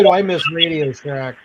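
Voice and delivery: normal talking voice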